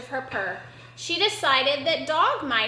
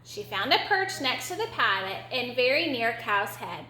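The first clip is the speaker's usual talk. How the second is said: ordinary delivery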